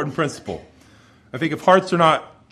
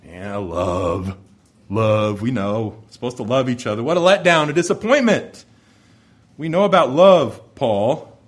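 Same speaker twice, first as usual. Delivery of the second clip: deflated letdown; 4 semitones lower than usual. Acted. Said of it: "you can see their shoulders kind of sink".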